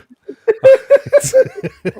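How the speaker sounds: shrieking burst of laughter; loud high laugh. Real, not acted. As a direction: howl with laughter. Sheep head joke.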